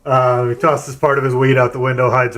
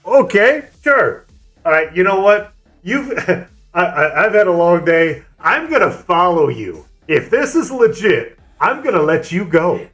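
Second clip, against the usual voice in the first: higher, incredulous cop with laughter breaking in; a lightly voiced state trooper; mock skeptical cop; 6 semitones higher than usual. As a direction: play the cop who can't believe this story. Cop deciding to follow.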